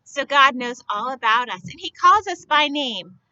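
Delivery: ordinary delivery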